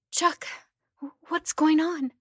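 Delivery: high, stammering and nervous. Acted. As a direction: ask it nervously, voice catching. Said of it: "sounding nervous".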